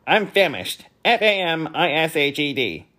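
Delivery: testy bee; impatient spelling bee. Acted. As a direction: hurry them along.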